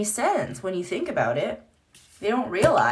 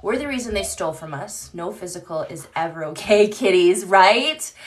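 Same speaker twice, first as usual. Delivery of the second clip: ordinary talk, nothing marked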